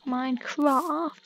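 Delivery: "Minecraft" stretched slow with a long ah; posh pronunciation. Shown as a demonstration. Vlogger surprised at own speech, "Minecraft" in posh long ah. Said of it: "I'm apparently posh now".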